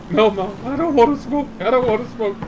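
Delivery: put-on high, loud protesting kid; pleading kid. Son begging mom he doesn't want to smoke, high loud plea. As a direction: beg your mom to stop.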